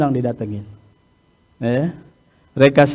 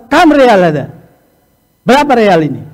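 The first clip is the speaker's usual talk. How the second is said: loud, sharp price question; snapped demand; 4 semitones higher, 5 dB louder than usual. Retold or imitated. Trader loudly demanding the price in riyals. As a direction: bark the price question at the buyer.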